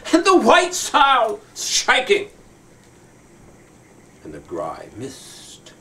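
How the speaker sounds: slow, dramatic poem reading with long pauses; poem recitation. Acted. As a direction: recite it like a grand sea poem.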